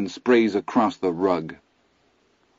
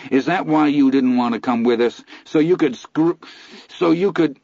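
higher and louder, building toward a break; rising accusation; 7 semitones higher, 4 dB louder than usual. A narrator's reading. Son confronting his father, higher and louder. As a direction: hurl it at him, voice climbing.

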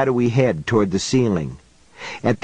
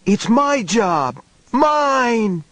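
high, slow, insistent cry on MY and MINE; a child claiming what is his, shouting it higher; frantic boy yelling; 11 semitones higher, much slower than usual. A narrator's reading. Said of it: "he screamed".

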